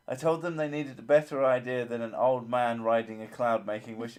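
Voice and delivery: normal talking voice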